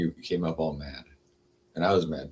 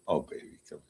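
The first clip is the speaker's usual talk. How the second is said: soothing the cat; 11 dB quieter than usual. Real, not acted. Coaxing her upset cat Lola to come close, softly.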